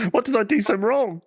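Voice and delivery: very high, pitiful mascot voice; hammed-up wounded whine; mock lament. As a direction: plead in a squeaky, put-on voice. Acting out a forlorn discarded mascot.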